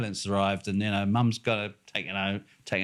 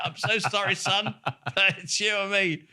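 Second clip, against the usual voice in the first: laughing mock apology; 11 semitones higher, slower than usual. Mock-apology to the rival driver.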